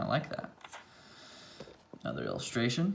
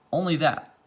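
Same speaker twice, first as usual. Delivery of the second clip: ordinary delivery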